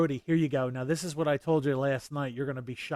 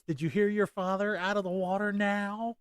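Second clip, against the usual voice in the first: higher-pitched mock scolding of a child; retold mother's yell; 6 semitones higher than usual. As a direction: imitate a mom calling her kid in, pitched up. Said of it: "and she yells".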